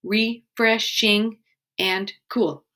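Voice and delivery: Refreshing stretched syllable by syllable; slow syllable count. Shown as a demonstration. Teacher counting syllables of the haiku line, slowly. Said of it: "Let's count our syllables".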